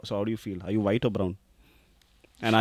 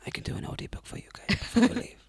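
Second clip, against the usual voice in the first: high, whispery mock echo; put-on whispery audiobook voice, pitched way up; mocking imitation; 13 semitones higher, 5 dB quieter than usual. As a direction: mimic her in a soft, high whisper. Mockingly echoes her audiobook offer.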